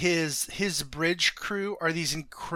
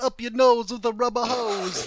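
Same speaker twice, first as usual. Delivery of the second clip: Vinnie Barbarino voice; 6 semitones higher, 4 dB louder than usual. Retold or imitated. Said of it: "he'd be like".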